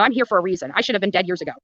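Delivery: ordinary delivery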